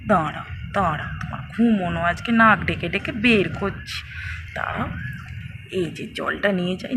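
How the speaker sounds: scheming mother. Acted. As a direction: plot the prank under your breath.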